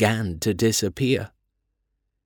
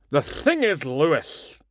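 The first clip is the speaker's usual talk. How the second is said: put-on old headmaster voice; 3 semitones higher, slower than usual. A narrator's reading. Headmaster mispronounces name.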